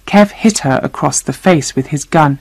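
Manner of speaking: plain reading voice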